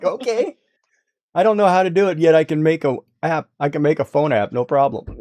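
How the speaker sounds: high, loud sarcastic exclaim; sarcastic mock. Mocking his mother's logic.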